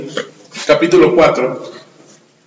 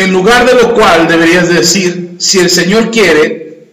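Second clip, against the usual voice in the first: louder, measured Bible reading; scripture reading; 4 dB louder than usual. Acted. Pastor reads Santiago chapter four aloud to the church, proclaiming. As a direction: proclaim the verses to the congregation.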